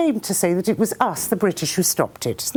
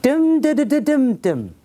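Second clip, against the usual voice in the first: tapped-out gun rhythm, high and louder; machine-gun rhythm; 6 semitones higher, 3 dB louder than usual. Retold or imitated. English gunners play a tune with the machine gun, rhythmic. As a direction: beat out the machine gun in syllables. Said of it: "do their machine gun like this".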